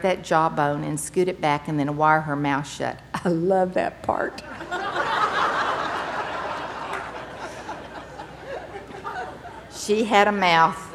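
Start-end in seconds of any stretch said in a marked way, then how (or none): 3.1-4.4 slow, laughing through the words. Real, not acted. Laughing at daughter's wired jaw. 4.5-9.7 high, soft rising laugh. Real, not acted. Laughter after the joke.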